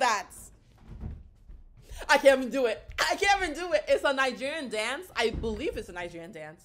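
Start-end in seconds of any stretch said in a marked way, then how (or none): 2.1-3.8 loud, excited outburst. Real, not acted. Reacting to a dance on video, excited. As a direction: raise pitch and volume on the repeat.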